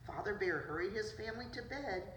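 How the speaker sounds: ordinary delivery, nothing marked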